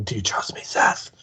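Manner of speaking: hushed persuasive whisper. Retold or imitated. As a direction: lean in and whisper it. Mock guru persuading Seth.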